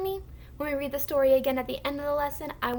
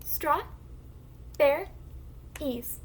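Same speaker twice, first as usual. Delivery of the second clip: Straw, bear, ease as three isolated beats; syllable by syllable; 3 dB quieter, slower than usual. Shown as a demonstration. Teacher segments a word for the class, syllable by syllable. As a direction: leave a clear gap after each syllable. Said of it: "Let's try another example".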